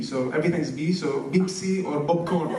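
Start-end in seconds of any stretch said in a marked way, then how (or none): 1.3-2.5 "Pepsi or popcorn" with B sounds for P. Retold or imitated. Comic listing drinks Arabs can't say, P-as-B. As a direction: say "Pepsi" and "popcorn" with every P turned to B. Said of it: "Arabs can't say P".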